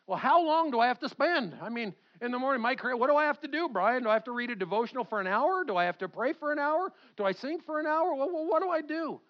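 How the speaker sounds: high, hurried string of worried questions; flustered questioner. Acted. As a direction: act out a believer panicking over requirements. Voices flustered worried believer's questions.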